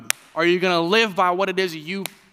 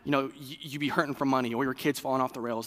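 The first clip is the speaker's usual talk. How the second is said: ordinary talk, nothing marked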